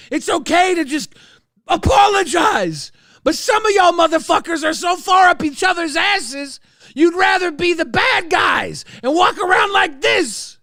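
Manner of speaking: loud, high, furious rant; angry shout. Real, not acted. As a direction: shout it out at them, fed up. Angry rant at people online.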